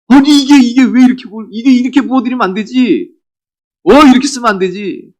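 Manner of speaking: high, loud scolding protest; angry disciples. Acted. Disciples scolding a woman over wasted perfume, angrily. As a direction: object indignantly, voice pitched right up. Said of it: "그러면서 막 화를 내요".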